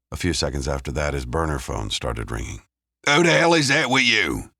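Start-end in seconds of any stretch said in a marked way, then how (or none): 3.1-4.5 loud, high-pitched angry bark; barked demand. A narrator's reading. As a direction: bark it down the phone line. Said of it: "Sid's voice barked down the line".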